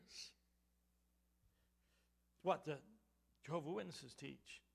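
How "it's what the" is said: normal talking voice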